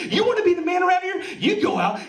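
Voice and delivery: shouted high and loud; furious mock dad shout; dad yelling. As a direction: yell it at the kid like an enraged dad.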